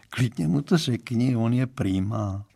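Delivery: plain reading voice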